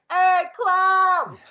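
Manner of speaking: calling out. Retold or imitated. Bronx passerby hollers at the clown, loud and high. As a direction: shout it across the street. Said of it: "would be like".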